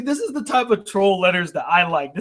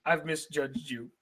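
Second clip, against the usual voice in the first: low, much softer admission; hushed apology; 6 semitones lower, 15 dB quieter than usual. Real, not acted. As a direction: let the apology sink to a murmur. Same apology repeated, much softer.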